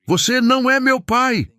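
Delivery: put-on the boy yells his denial; bellowed. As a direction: scream it at the stranger. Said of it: "ele berrou".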